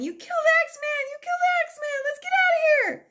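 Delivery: high panicked shouting; screaming kids running from a freak show; yelling. Retold or imitated. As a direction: yell it in a wild panic. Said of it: "So we start yelling".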